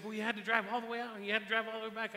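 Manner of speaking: rushed retold insistence; retold insistent protest. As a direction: protest in his voice, hurried and emphatic. Retold man protests apologetically.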